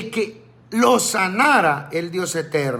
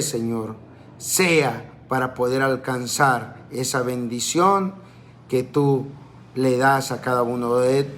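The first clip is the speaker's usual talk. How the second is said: fervent prayer; 4 semitones lower than usual. Acted. Pastor praying over the congregation, low reverent prayer. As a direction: pray reverently to the Lord, lower than speech.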